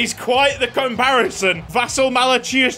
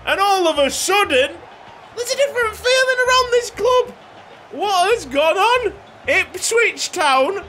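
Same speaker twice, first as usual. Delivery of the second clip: excited goal call; 12 semitones higher than usual. Real, not acted. Player calling his defender's goal against Spurs, excited commentator roar. Ipswich scores, commentator-style celebration.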